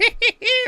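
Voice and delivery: high, loud wheezy cackle; prospector cackle. Acted. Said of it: "prospector beard".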